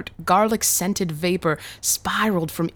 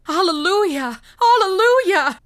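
very high exultant cries, climbing higher; jubilant shouting; 5 dB louder than usual. A narrator's reading. Revived boys leap up rejoicing, climbing jubilant shouts. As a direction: shout praise at the top of the range. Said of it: "they shouted".

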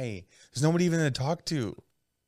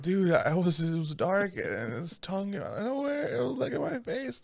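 mock body-part voice; 4 semitones higher, 6 dB quieter than usual. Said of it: "My asshole's all like".

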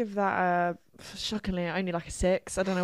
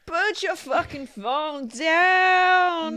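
mock scolding shout; 6 semitones higher, 3 dB louder, much slower than usual. Scolding an imagined dad.

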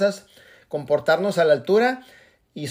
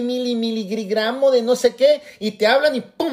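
ordinary talk, nothing marked

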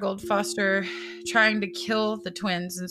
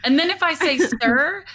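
animated; 8 semitones higher, 7 dB louder than usual. Real, not acted. Animated, amused.

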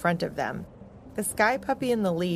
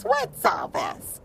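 tiny, high, slow breathy voice; a little creature crying out; small high voice; slower than usual. Acted. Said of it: "cried a small voice".

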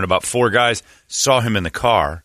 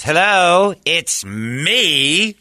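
Jon Lovitz voice; 9 semitones higher, much slower than usual. Retold or imitated. Lovitz calls out from the car, grand showy impression. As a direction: announce yourself grandly, drawing out the last word. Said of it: "In that voice".

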